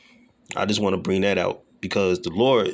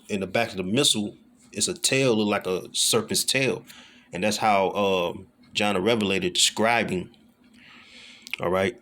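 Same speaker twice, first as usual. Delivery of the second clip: normal talking voice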